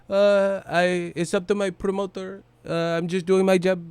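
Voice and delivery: deferring to his promoter Pacquiao-style; Pacquiao impression. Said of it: "talking about it's up to your promoter".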